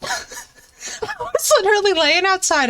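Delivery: high, laughing through the words; giggling while telling it. Real, not acted.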